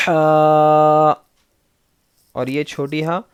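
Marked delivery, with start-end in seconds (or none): 0.1-1.1 ہا pushed from the throat, louder; ha from deep inside. Shown as a demonstration. Teacher demonstrates the big ha from inside. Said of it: "بڑی ہا مطلب اندر سے آئے گا".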